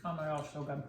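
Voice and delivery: speaking between crunchy bites; mumbled through a full mouth. Real, not acted. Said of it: "talking with our mouth full".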